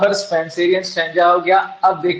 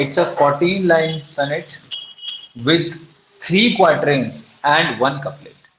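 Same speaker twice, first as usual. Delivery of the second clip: very slow, word by word, so students can write it down; drawn out for note-taking; slow dictation; much slower than usual. Shown as a demonstration. Teacher dictating a definition to the class, very slow. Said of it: "तो आप इसमें लिख देंगे".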